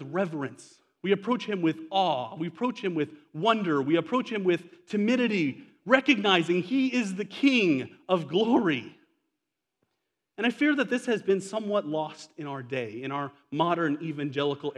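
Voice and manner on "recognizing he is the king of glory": ordinary delivery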